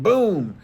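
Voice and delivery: a sudden bang mimicked by voice; imitated gunshot boom. Dad retelling son's shot at the range, punchy boom. Imitates gun firing in story.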